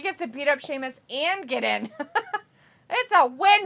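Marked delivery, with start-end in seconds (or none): 2.9-3.7 high, loud delighted exclamation; excited cheer. Real, not acted. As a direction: cheer it out, raised pitch. Cheering the match outcome.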